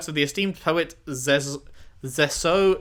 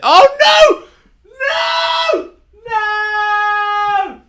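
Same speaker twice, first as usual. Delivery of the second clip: high, loud cries of disbelief; anguished shouting; 11 dB louder, slower than usual. Real, not acted. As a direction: scream no like your heart just broke. Shock at discovering Jacob.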